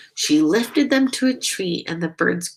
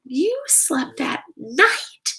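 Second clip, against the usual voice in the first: gasp; 6 semitones higher, slower than usual. A narrator's reading. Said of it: "gasped another".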